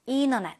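"internet" said as "innernet"; relaxed "internet" without the first t; internet with dropped t. Shown as a demonstration. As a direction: let the t in "internet" vanish into the n. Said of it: "N 발음의 트가 동화가 돼서".

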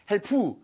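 "help" stretched into "hel-poo"; added final vowel. Shown as a demonstration. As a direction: put an "oo" after the p in "help". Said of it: "이러면 들릴 것 같지만 다른 단어". ("help" 끝에 '푸'를 붙인 발음)

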